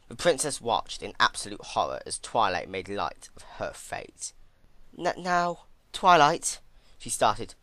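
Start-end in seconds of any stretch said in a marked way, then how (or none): 5.0-6.6 high stammering worried voice. A narrator's reading. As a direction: stammer her name out in rising fear. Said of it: "her worry extremely apparent".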